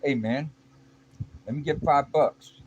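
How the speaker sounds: high, loud demanding call; street hustler. Retold or imitated. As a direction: shake him down for cash. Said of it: "one guy comes up to me and goes".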